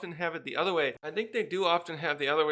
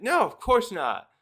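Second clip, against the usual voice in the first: higher, louder, slow refusal; indignant; 5 semitones higher, 7 dB louder than usual. Acted. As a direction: refuse it haughtily. Girl rebuffing boy.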